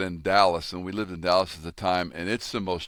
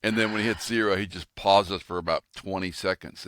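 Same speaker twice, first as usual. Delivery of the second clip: usual speaking voice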